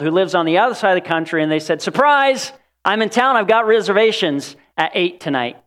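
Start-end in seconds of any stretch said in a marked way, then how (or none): 1.8-5.7 high, bright call of surprise; cheerful surprise, voice jumping up; excited surprise. Retold or imitated. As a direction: burst in like a friend arriving. Excited friend calling.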